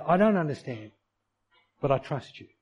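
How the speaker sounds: normal talking voice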